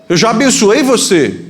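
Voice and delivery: high, insistent protest; acted disbelief. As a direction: protest as a startled father. Father in acted dispute.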